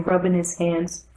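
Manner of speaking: ordinary delivery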